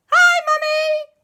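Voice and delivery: put-on very high, loud kid shout; high child greeting. Boy greeting his mother, very high and loud. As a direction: squeal hello like a little boy. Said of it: "Out stepped Christopher".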